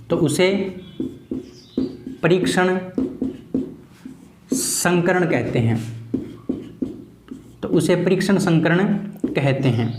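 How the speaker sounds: "तो उसे परीक्षण संकरण कहते हैं" broken into slow chunks with long pauses; teacher repeating the definition slowly for students to write; slow dictation. Shown as a demonstration. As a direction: spell it out for note-taking, slowly. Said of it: "repeated slowly for dictation".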